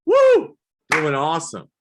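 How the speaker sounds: high, loud whoops to a stranger; manic whooping. Retold or imitated. Comic answering a passerby with loud whooping. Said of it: "on the street, I'd be like".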